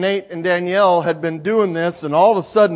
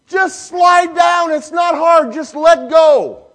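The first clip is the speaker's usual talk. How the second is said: slow, loud, higher coaxing call; urging shout; 11 semitones higher, 8 dB louder, slower than usual. Retold or imitated. Father calls up the slide to his daughter, slow loud coaxing. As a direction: call up firmly but kindly.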